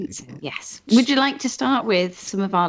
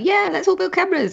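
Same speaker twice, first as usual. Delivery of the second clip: excited imitation; 14 semitones higher than usual. Mimicking Ethan's eager suggestion.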